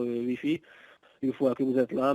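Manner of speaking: usual speaking voice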